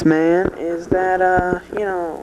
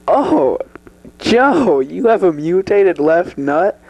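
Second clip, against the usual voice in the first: ordinary talk, nothing marked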